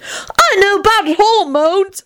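put-on very high little-kid voice; high, eager child's chirp; happy piping child. A narrator's reading. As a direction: pipe up happily like a small child. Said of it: "Teddy piped up happily".

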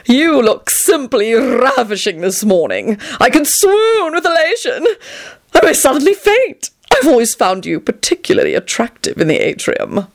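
high, swooning flattery; shrill delight. A narrator's reading. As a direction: gush like a melodramatic admirer. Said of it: "he shrilled delightedly".

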